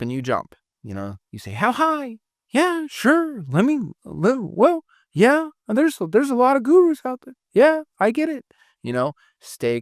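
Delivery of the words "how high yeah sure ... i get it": way higher, hammed-up people-pleaser voice; mock the closer who jumps when told; squeaky, overeager mock agreement; mock eager yes-man. Mocking an eager, reactive closer.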